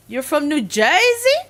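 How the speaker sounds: a half-hearted New Jersey accent on New Jersey; mock New Jersey accent; weak New Jersey accent. Shown as a demonstration. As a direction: try a light New Jersey accent, loud and high. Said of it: "My weak impression to say, uh, of saying New Jersey".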